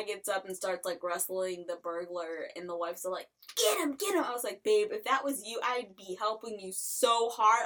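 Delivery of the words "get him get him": high, loud urging yell; mock cheering. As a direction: egg him on, shrill and loud. Wife voiced cheering husband.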